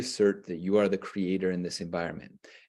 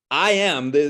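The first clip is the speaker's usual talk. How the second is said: loud, raised mock proclamation; bold declaration; 5 semitones higher, 8 dB louder than usual. Speaker acting out the mind's new declaration, bold and loud. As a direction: declare yourself with playful force. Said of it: "that's the declaration we make".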